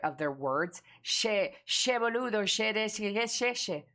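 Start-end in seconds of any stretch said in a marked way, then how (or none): 1.0-3.8 higher-pitched mock Argentinian, full of sh; Argentinian accent. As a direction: parody Argentinian Spanish with repeated sh sounds. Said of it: "the Argentinian accent".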